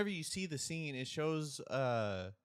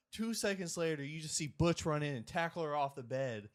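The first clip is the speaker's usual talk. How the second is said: usual speaking voice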